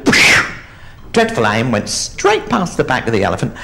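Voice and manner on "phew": short, loud blown whoosh; coconut launch noise. Acted. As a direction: puff out the sound of a thrown coconut. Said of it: "aim this coconut and go".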